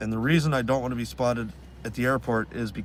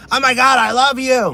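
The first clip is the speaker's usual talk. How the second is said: loud, high-pitched gushing shriek; mock fangirl yell, sudden and full force; screaming fan; 11 semitones higher, 9 dB louder than usual. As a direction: imitate a woman erupting with adoration. Imitating woman's sudden fan outburst.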